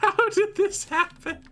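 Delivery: high, slow whiny lament; mock despair. Gamer mourning his ninety-eight gold, mock lament. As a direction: wail it like a hammed-up tragic hero.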